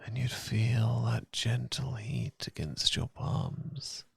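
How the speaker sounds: ordinary talk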